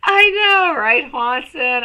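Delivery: thrilled squeal of agreement; excited. Real, not acted. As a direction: burst out delighted. Excited reply to chat.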